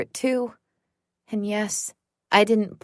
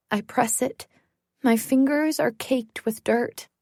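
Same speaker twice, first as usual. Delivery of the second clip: ordinary delivery, nothing marked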